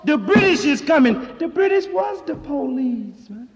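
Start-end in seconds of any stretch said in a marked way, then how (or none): 0.0-1.2 loud, high alarm call; Paul Revere's alarm. Retold or imitated. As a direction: ride through crying the warning. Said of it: "Paul Revere rode ... and said".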